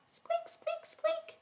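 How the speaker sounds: tiny, very soft high squeaks; mouse squeaks. Pretend. Storyteller plays the tiny mouse for young listeners, faint squeaking.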